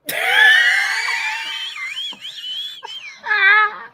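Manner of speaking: shrieking burst of laughter; loud high laughter. Real, not acted. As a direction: howl with laughter. Sudden laughing fit.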